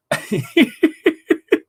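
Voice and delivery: shrieking burst of laughter; high-pitched laugh. Real, not acted. Laughing at the memory.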